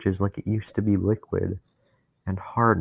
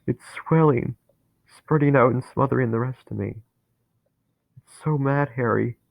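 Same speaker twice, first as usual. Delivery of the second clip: higher, louder, panicked voice; rising dread; 4 semitones higher, 3 dB louder than usual. Acted. Dennis feeling hate take him over, panicking. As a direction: feel the bitterness swelling, alarmed.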